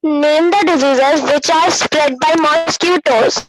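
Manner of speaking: loud reading aloud, close on the mic. Real, not acted. Student reading a question to the teacher, very loud. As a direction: read the question out far too loudly. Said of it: "बहुत जोर से आवाज आती है".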